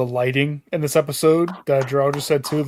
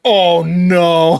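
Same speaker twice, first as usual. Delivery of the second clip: dismayed; 4 semitones higher, 6 dB louder than usual. Retold or imitated. Retold dismay at the scene.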